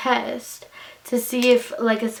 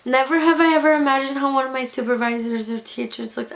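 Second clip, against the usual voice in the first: ordinary talk, nothing marked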